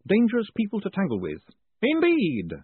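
put-on high, lively old professor; chatty academic explaining cheerfully; professor's voice. A narrator's reading. Professor chats to Moist, high and lively.

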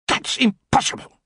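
high, sharp indignant outburst; queen shouting. A narrator's reading. Queen to goblin, indignant shout. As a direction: snap at the goblin in outrage. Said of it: "she shouted".

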